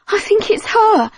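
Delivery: high, loud frantic shout. Acted. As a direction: yell it out in alarm. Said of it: "Marley shouted".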